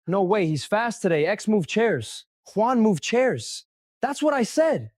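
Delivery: teasing excitement. Acted. Teasing gossip about Juan.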